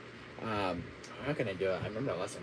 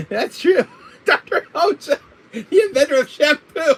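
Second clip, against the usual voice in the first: high giggling words through laughter; laughing; 10 semitones higher, 5 dB louder than usual. Real, not acted. Laughing at own joke.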